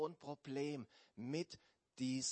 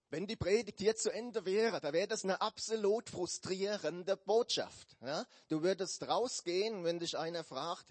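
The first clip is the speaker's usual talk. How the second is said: louder, higher pulpit voice; preaching; 5 semitones higher, 6 dB louder than usual. Real, not acted. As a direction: preach it with force. Preaching with rising force.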